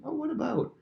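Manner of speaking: very high-pitched prying question; feigned curiosity. Acted. Prince drawing out a visitor's grievance, feigned curiosity. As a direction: sing it up high, all ears. Playing Absalom's feigned interest.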